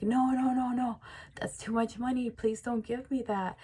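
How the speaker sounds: high-pitched, overwhelmed protest; flustered refusal. Acted. You to the woman handing over money, flustered refusal. Acted refusal of five hundred dollars.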